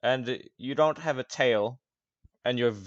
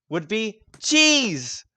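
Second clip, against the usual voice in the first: higher, louder reveal of Cheese; game master introducing the next hero; announcing; 11 semitones higher, 7 dB louder, much slower than usual. Acted. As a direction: present the new arrival with flair. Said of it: "announcing the next character".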